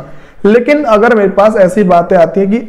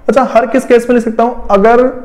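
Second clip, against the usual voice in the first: normal talking voice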